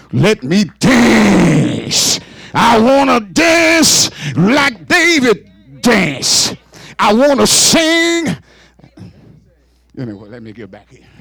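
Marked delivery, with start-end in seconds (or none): 0.1-8.4 slow, drawn-out preacher's shout; shouting praise. Real, not acted. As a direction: stretch each word out in jubilant praise.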